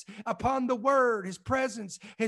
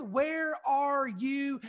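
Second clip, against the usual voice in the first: slow, desperate cry to God; shouted plea; much slower than usual. Acted. Preacher voicing a believer crying out to God, slow shout. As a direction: call out where are you, drawn out.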